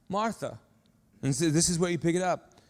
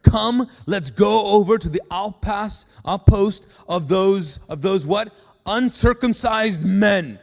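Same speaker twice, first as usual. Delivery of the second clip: loud, slow, rousing call to go; rallying call; 2 semitones higher, 9 dB louder, slower than usual. Acted. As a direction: pump up the armor bearer. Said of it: "Jonathan said to his young armor bearer".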